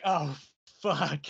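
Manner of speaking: low, quiet sinking groan of a reaction; retold dismay. Comic reader reacting to a bad plot turn, dismayed. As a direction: let it drop, slow and soft.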